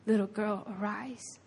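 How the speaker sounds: soft, hushed invitation; quietly acted tender call; tender, relational. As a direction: say it gently, close beside her, fading away. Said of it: "he did it in such a relational way".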